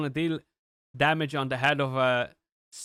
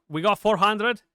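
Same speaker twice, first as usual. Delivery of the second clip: higher and louder, a burst of joy at the milestone; excited cheer; 6 semitones higher, 6 dB louder than usual. Real, not acted. Gamer cheers reaching four hundred million, excited. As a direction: celebrate hitting the goal. Hits damage goal mid-game.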